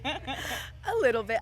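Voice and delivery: laughing through a short answer. Real, not acted. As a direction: giggle out the words. Laughs at cat question.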